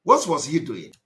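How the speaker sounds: ordinary delivery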